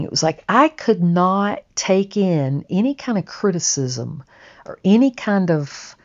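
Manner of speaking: normal talking voice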